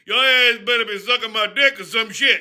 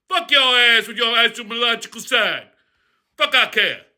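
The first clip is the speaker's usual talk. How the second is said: usual speaking voice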